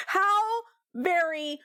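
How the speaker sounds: hammed-up indignant outburst with a laugh; mock outrage. Mock-offended defendant telling Jake off in court. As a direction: play offended in front of the judge. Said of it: "I'm getting heated".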